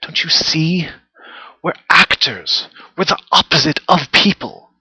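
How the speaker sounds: desperate appeal to be understood; anguished plea. Acted. Actor pleads to be understood.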